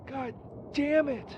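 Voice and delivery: ordinary talk, nothing marked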